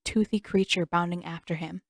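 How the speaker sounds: ordinary delivery, nothing marked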